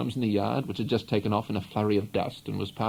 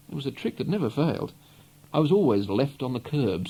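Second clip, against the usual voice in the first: ordinary delivery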